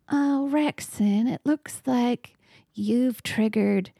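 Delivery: slow, hammed-up menacing voice. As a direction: draw it out like a mock demonic threat. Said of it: "in my most menacing voice".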